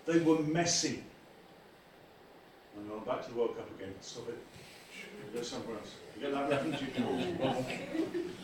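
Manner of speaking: usual speaking voice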